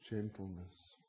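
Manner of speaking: normal talking voice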